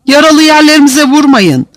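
loud, high-pitched pleading; pained plea. A narrator's reading. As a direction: beg them to stop hitting. Beaten prisoner begs guards.

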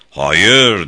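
loud, emphatic denial; Beşvayo. A narrator's reading. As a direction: answer your own question forcefully. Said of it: "diye cevapladı sonra kendi sorusunu". (yüksek sesle, kesin bir ret)

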